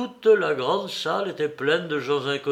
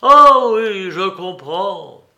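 a loud, high burst on "Ah oui", then slower; suddenly understanding, he cries out; full-voiced cry; 5 semitones higher, 13 dB louder, slower than usual. A narrator's reading. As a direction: cry out at full voice as it dawns on him. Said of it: "il s'écria à pleine voix".

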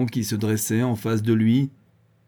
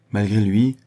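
ordinary delivery

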